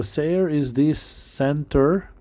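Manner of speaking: usual speaking voice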